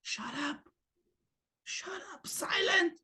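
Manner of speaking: soft, shushing command; quiet inner "shut up"; mock hush to yourself. Shown as a demonstration. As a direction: hush your own mind firmly but softly. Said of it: "Tell yourself".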